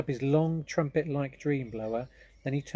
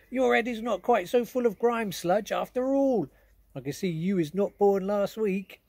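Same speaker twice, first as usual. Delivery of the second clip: higher, louder jolly giant voice; friendly giant; 9 semitones higher, 7 dB louder than usual. Acted. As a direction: praise the girl like a big kindly giant. BFG praises Sophie.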